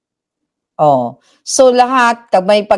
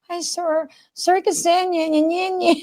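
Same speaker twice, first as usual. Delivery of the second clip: higher, softer, sugary voice to charm the boss; sweet coaxing voice; 7 semitones higher, 6 dB quieter than usual. Retold or imitated. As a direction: coo it sweetly so he won't recognise you. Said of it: "naglambing-lambing ako". (malambing na boses, mas mataas at mahina)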